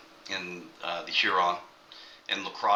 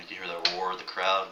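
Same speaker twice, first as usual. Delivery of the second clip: normal talking voice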